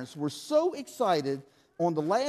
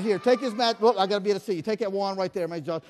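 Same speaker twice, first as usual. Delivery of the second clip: ordinary delivery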